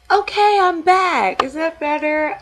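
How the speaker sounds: cheerful return. Real, not acted. Back from fixing the camera, calling out cheerfully to viewers. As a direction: bounce back on camera full of energy.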